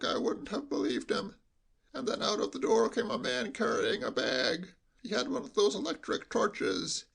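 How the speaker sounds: hushed, high sheep-like bleat. Acted. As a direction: bleat it confidentially, as through cotton wool. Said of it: "in a lowered voice".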